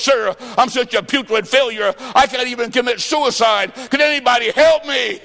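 preacher voicing a despairing woman; pleading woman. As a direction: voice the broken woman begging for help. Suicidal woman voiced by preacher.

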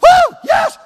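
a hammed-up victory yell; cocky young athlete's whoop; mock fired-up whoop. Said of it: "These young boys get up now and go".

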